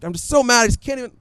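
high-pitched mock fuming; retold anger. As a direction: act out being too mad to finish. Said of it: "I remember being so angry".

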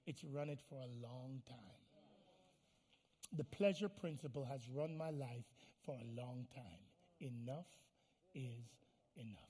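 low, very soft and deliberate; hushed resolve. Acted. Believer tells God the body's rule is over, in hushed resolve. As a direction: let it sink almost to nothing, pausing between words.

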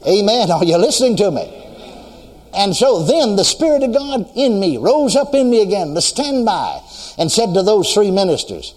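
full-force preaching. Acted. Preacher stirring the congregation about the standby, full-force preaching. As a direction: preach it out with rising fire.

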